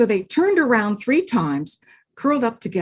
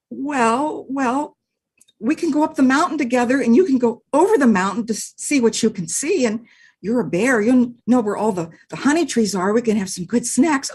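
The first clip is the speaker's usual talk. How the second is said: fast, high, rushed persuading; eager puppy rush; eager little dog; 4 semitones higher, 4 dB louder than usual. Acted. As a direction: babble to win him over.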